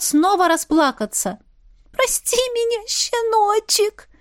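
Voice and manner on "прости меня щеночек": put-on high, pleading girlish voice, drawn out. A narrator's reading. Polly begs the baron's forgiveness, high and pleading. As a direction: beg him sweetly, stretching the last word. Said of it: "умоляюще прошептала она".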